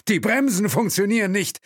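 high, loud cry of panic; panicked shout. Acted. Driver reporting failed brakes, in panic. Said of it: "Panik in der Stimme".

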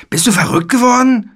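very high, loud outraged question; indignant shout. A narrator's reading. Boy just jabbed by the knight, shouting indignantly. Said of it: "schrie Philipp empört".

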